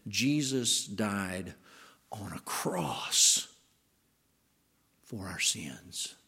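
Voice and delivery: slow, hushed weight on "cross"; pause, then drop to a breathy "on a cross"; stress on cross. Shown as a demonstration. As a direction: draw it out solemnly, "cross" sinking almost to a whisper. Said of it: "But have we ever said this?".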